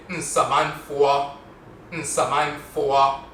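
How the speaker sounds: Nsamanfo said slowly, final fo dipping; Asante Nsamanfo. Shown as a demonstration. Teacher models the Asante Nsamanfo slowly, with a dipping glide. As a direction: stretch Nsamanfo, let the fo glide down. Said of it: "In the Asante dialect, they say".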